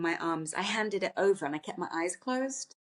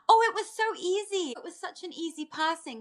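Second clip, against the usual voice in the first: light high retelling; 9 semitones higher than usual. Retold or imitated. Medium voices dog's relieved message.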